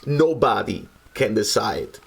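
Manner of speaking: ordinary delivery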